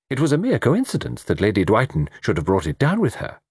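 animated armchair critic; opinionated Mister Satterthwaite. Acted. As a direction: hold forth with admiring, swooping emphasis. Satterthwaite holding forth in dialogue.

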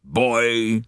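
put-on old man's quavering call; hoarse old gentleman. A narrator's reading. Old gentleman calling the steward, hoarse voice. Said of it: "A hoarse, quavering voice".

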